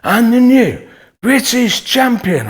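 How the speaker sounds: loud, high, slow drawn-out boxing MC call; announcer voice. Retold or imitated. Ring announcer crowning the new champion, slow drawn-out call. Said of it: "The man with the microphone went like this".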